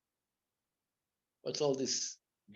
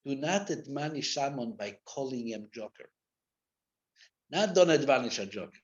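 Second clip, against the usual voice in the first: ordinary talk, nothing marked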